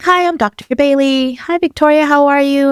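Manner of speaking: high, lilting phone greeting; Doctor Bailey. Retold or imitated. On-call doctor calling a patient back, high-pitched greeting. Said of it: "there's a lady and she's like".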